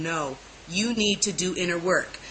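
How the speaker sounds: calm, quiet admonition from within; voice of the Lord. Retold or imitated. The Lord speaking within her, quietly firm. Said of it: "the Lord keeps coming within me and saying".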